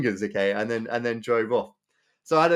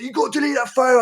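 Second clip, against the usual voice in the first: high mock-angry order; imitated angry demand; 10 semitones higher, 4 dB louder, faster than usual. Driver demands the photo be deleted, mock angry. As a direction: push the pitch up, mimicking an irate driver. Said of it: "the angry guy".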